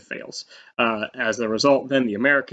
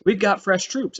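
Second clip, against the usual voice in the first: eager America; 8 semitones higher than usual. Retold or imitated. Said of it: "America joins the Allies and says".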